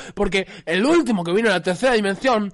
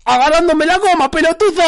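loud, raised-pitch heckle; jeering shout; 5 semitones higher, 5 dB louder than usual. Acted. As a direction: yell it as a crude taunt. Crude jeering answer to the question.

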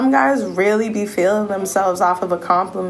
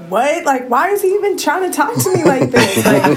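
incredulous; 6 semitones higher, 4 dB louder than usual. Retold or imitated. Baffled retelling of his texts.

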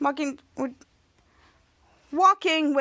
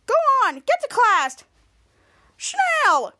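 higher, louder scolding order; mock teacher yell; 9 semitones higher, 5 dB louder, slower than usual. Teacher ordering the kids to class, mock yell. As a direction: chase them off to class.

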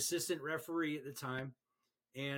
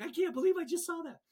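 much higher, fast, stunned mock disbelief; frustrated disbelief; 6 dB louder, faster than usual. Shaken new referee after a flying kick, in high, fast disbelief. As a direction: imitate a rattled referee's shock. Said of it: "he was so frustrated 'cause he was like".

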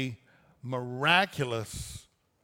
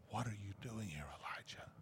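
soft unvoiced whisper, much softer; gentle whisper; 5 semitones lower, 18 dB quieter, faster than usual. A narrator's reading. The Lord asks Elijah after the fire, whispered. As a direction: breathe it like a still small voice. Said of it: "a gentle whisper, or still small voice".

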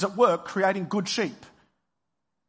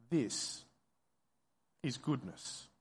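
softer, low, with a long pause after THIS; said to oneself, broken up; 3 semitones lower, 10 dB quieter, slower than usual. Acted. Preacher repeating the listeners' inner words, hushed and halting. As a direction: let THIS hang, then quietly finish "is goodness". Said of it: "And then say to yourself".

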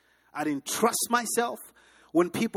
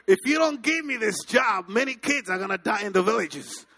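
loud, pleading cry for the job; desperate begging shout; 4 semitones higher, 5 dB louder than usual. Retold or imitated. Pleading with his boss to keep his job, shouting desperately. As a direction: shout it as if lives depend on it. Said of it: "I begged".

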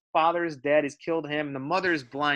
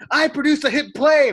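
boastful character quote; 10 semitones higher, 9 dB louder than usual. Retold or imitated. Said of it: "he's like".

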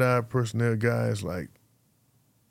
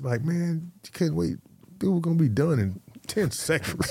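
mildly annoyed, pitched higher; retold annoyance; 6 semitones higher than usual. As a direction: grumble it like a rookie who got bumped. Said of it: "I'm pissed".

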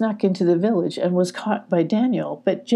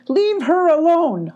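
high, drawn-out yell; hollering; 10 semitones higher, 4 dB louder than usual. A narrator's reading. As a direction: holler it to defend a friend. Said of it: "hollered Jake".

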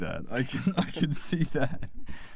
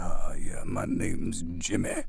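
put-on deep, slow voice for a kid character; opposite-to-looks voice; 7 semitones lower, slower than usual. Actor auditioning for kid Jimmy as a joke, deep slow voice. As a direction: drop it low and draw out the name. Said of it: "the character looks like a kid".

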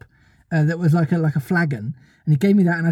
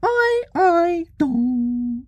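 very high, sustained squeaky tones; tiny fairy voice; 9 semitones higher, much slower than usual. A narrator's reading. Tinkerbell answering Peter's call, high fairy voice. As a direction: sing it out like a little bell.

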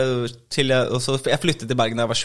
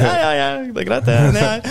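flustered reply; 13 semitones higher, 3 dB louder than usual. Retold or imitated. His flustered reply to heckler.

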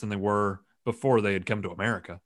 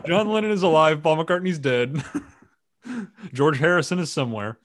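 mock claims; 5 semitones higher, 3 dB louder than usual. Mock conspiracy claims, laughing.

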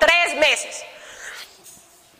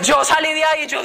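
ordinary delivery

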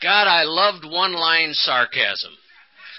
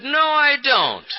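mock denial; 10 semitones higher, much slower than usual. Husband denial, laughing.

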